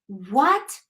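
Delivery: ordinary talk, nothing marked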